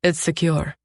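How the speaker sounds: understated, offhand assurance; nonchalant. A narrator's reading. Captor to captive searching for escape, nonchalant. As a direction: toss it off as no concern. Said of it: "he says nonchalantly".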